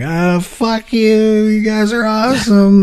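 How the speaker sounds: high, drawn-out sedated sigh of bliss; sudden chemical calm, words stretched high and slow. Retold or imitated. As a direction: croon it slowly, blissfully drugged. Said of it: "instantly I was calm. I was like".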